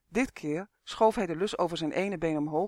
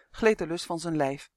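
plain reading voice

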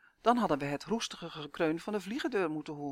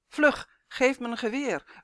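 louder, higher and slow, a sudden alarmed call; urgent shout; 7 semitones higher, 7 dB louder than usual. Acted. Husband wakes in the night, demands a gun, loud shout. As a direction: shout it out, half-awake and panicking. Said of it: "riep hij luider dan net".

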